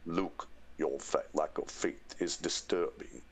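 low, slow, measured villain voice; deep, deliberate dark-lord impression; Darth Vader. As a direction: intone it low and slowly with menace. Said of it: "Darth Vader as James Earl Jones".

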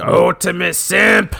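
gravelly mock promo bark; Sergeant Slaughter. Podcaster inventing insults in the wrestler's gravelly voice. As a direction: stretch the made-up insult like a wrestler. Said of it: "Should have called him".

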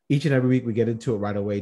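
rushed, words tumbling out quickly; talking so fast. Real, not acted. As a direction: race through it in one breath. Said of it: "I'm sorry I'm talking so fast".

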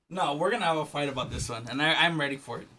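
ordinary talk, nothing marked

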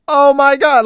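loud, high cry of alarm; startled, high-pitched mock shout; scared driver. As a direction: imitate a panicked driver yelling. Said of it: "they were probably scared".

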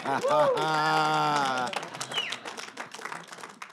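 a laugh that turns toward tears; choked laugh. Real, not acted. Survivor overcome before the crowd, breathy laugh.

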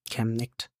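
plain reading voice

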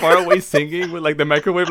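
normal talking voice

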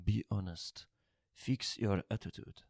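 soft imitation of a nagging mother in an accent; mother's voice. Son hearing his mother's advice in his head, softly imitated mother's voice. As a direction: quietly put on mom's accented voice. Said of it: "hear my mother's voice saying".

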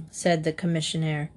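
usual speaking voice

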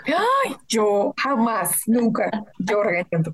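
drawn-out, playful denial; slow, exaggerated protest of innocence; mock indignation. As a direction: feign being offended by the accusation. Playfully denies ever scolding her friend.

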